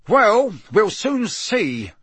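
a touch of a growl, stretched slowly. A narrator's reading. Mister Diggory answers the doubters, unimpressed growl. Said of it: "growled Mister Diggory".

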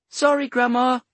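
put-on high, loud little-kid voice calling back; child's reply. A narrator's reading. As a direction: call back as an apologetic child. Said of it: "a child replied".

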